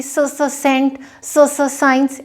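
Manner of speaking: doubled s before सेंट and साइंस; wrong doubled s; mock stutter on s. Shown as a demonstration. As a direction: stumble on the s sound as a bad example. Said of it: "ऐसे तो नहीं बोलेंगे".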